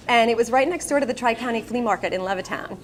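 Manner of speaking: usual speaking voice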